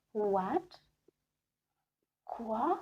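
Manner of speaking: What and Quoi each rising at the end; questioning intonation. Shown as a demonstration. Teacher showing how question words sound, rising What and Quoi. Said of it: "if it comes out as a question".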